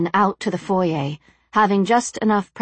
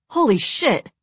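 higher, sudden exclamation; startled blurt; 6 semitones higher than usual. A narrator's reading. Alex blurts at the burning priest, startled. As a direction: blurt it out in shock. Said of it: "Alex blurted".

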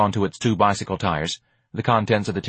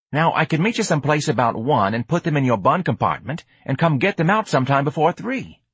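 higher, nasty-free casual patter; pitched-up street-kid explaining; streetwise hustler, earnest pitch; 7 semitones higher than usual. Acted. George explains his delivery problem, street-kid pitch.

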